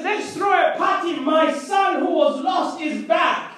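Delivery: high, shouted party announcement; joyful announcement. Acted. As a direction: call it out as the celebrating father. Father announces a celebration.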